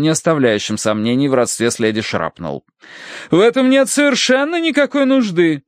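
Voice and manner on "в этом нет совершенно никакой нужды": high, imperious refusal; stern rebuke. Acted. Aunt refusing niece's offer to escort guest, rebuking. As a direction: put her down in a high matron's voice.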